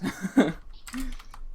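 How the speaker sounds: high squeaky laugh. Real, not acted. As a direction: let out a high giggle. Laughs at own joke.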